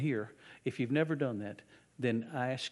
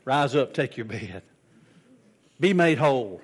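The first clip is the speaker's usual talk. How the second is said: commanding; 10 dB louder than usual. Retold or imitated. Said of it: "He said".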